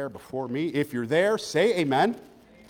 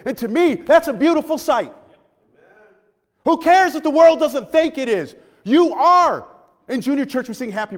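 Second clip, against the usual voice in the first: loud, raised-pitch preaching; impassioned preaching; 10 semitones higher, 12 dB louder than usual. Real, not acted. Preacher to insecure young people, impassioned preaching. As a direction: drive it home to the congregation.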